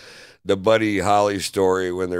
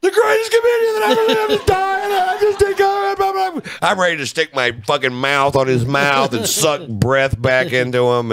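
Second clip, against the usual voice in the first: high, fast, hammed-up crying panic; mock sobbing; 7 semitones higher, 3 dB louder than usual. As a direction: wail it like your hero is dying, half joking. Thinks Kinison is dying, panicking.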